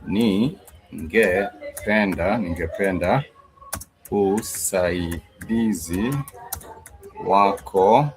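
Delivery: very slow, syllable by syllable; teacher drawing out each word; slow demo. Shown as a demonstration. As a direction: spell it out slowly for learners. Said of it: "which is, "I would like your assistance".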